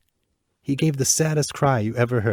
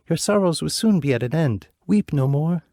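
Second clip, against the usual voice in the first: a hint of a comforting prince, higher; lightly voiced prince; 7 semitones higher, 4 dB louder than usual. Bird prince comforting the weeping princess, lightly voiced. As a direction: reassure her gently. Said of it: "he replied".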